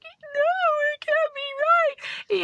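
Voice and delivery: very high, slow tearful squeak; hammed-up squeaky near-crying; tearful disbelief. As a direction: squeeze it out high, acting on the brink of tears. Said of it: "tears are welling up in my eyes".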